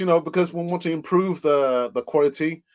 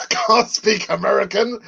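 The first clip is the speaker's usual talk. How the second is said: high, drawn-out mock American accent; attempted American accent; 6 semitones higher, 4 dB louder, slower than usual. Shown as a demonstration. Host obliging a request for American, hammed-up accent. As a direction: stretch it out in a put-on American accent. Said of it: "give us your best American accent".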